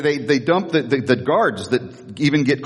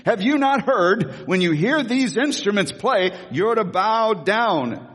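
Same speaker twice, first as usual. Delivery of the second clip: slow, higher, commanding king; king's command; 5 semitones higher, slower than usual. Acted. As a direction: lay down the king's law, drawn out. King voiced giving orders.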